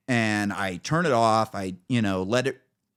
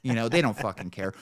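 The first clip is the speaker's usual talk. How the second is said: ordinary talk, nothing marked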